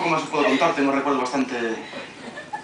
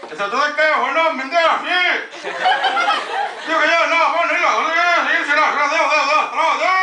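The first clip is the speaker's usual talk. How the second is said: hammed-up old men; 8 semitones higher than usual. Grandpas' garbled shouted chat.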